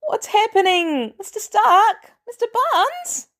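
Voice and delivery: high, slow, rising worried calls; anxious teen Peter. Acted. Peter calling to Mister Stark amid laughter, high and worried. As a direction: call out high and lost, like a bewildered kid.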